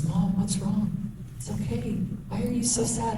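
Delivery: put-on high, soft little boy's voice; gently imitating her small son comforting her; young son's voice. Little son comforting his crying mom, in a soft high child's voice. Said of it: "my sweet little boy walked over and he goes".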